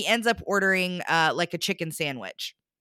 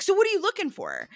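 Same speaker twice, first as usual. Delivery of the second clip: high, fast, pushy imitation; mock pushy question; 12 semitones higher, faster than usual. Date mocked, demanding answer.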